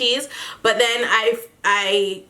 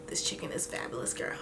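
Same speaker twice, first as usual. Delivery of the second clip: hushed, whispered imitation of mom; retold line in a breathy whisper; imitating mom; 16 dB quieter than usual. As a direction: whisper it, as if awestruck. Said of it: "she was like".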